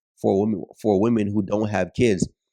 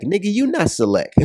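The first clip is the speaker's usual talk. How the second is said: high-pitched, incredulous comeback; incredulous retort; 8 semitones higher than usual. Real, not acted. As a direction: throw it straight back, pitched way up. Firing back at the comment.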